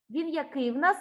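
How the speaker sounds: ordinary talk, nothing marked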